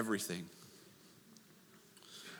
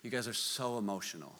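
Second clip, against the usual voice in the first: mock dismissive; 9 semitones lower, 11 dB quieter than usual. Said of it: "he's like".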